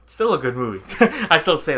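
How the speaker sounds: normal talking voice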